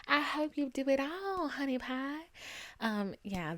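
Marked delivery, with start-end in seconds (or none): 0.1-2.2 lightly put-on Southern US accent, higher; Paula Deen accent. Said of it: "that was my Paula Deen accent".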